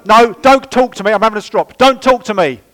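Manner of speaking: high, loud huffy snap; mock strop. Person in a strop telling others off, huffy mock snap. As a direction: shove people away in a hammed-up huff.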